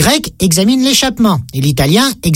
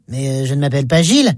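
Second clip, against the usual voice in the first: low, quick, hesitant reply; awkward correction; 8 semitones lower, faster than usual. Acted. Stranger mistaken for Gilles, awkwardly objecting. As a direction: correct him hesitantly, pitched well down.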